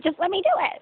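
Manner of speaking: put-on a child demanding to do it alone; impatient kid. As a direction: play the kid who won't be helped, whining high. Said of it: "your kid's like".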